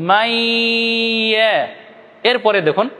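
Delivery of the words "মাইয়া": মাইয়া with the long া stretched slowly; drawn-out Arabic reading. Shown as a demonstration. Teacher demonstrating a stretched মাইয়া. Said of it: "উচ্চারণ কেমন করব?".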